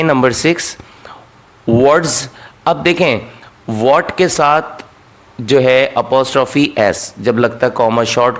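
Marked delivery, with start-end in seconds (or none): none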